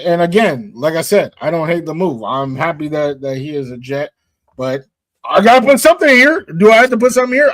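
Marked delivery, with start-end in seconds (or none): 5.2-7.5 loud, high, exasperated protest; exasperated self-defense. Real, not acted. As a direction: push back with voice raised and pitched up. Defends having to fill the list.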